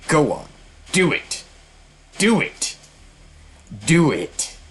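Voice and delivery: high, sharp urging; taunting goad. Acted. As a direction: egg him on with short, high jabs. Jeanne goads the bishop to roar.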